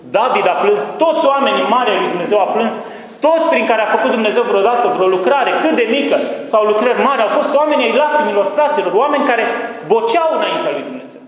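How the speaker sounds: high, loud impassioned appeal; fiery preaching. Acted. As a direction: plead with the congregation at full force. Preaching at full force. (predică aprinsă, sus și tare)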